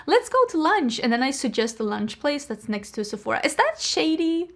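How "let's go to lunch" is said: innocent suggestion. Retold or imitated. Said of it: "Sometimes I'm like".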